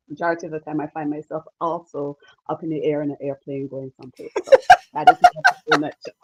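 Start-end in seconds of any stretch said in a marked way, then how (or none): 4.4-6.1 high, fast giggling finish; real laugh, much higher and louder. Real, not acted. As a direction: laugh through the last words. Laughing end of intro.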